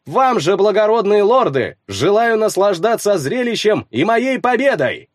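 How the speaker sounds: loud, high taunting call; shouting. Acted. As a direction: shout it to the lords. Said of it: "крикнул я".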